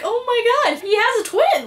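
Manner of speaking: high-pitched retold amazement; wide-eyed mock revelation; amazed realization. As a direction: act out suddenly believing it. Retells being won over by twist.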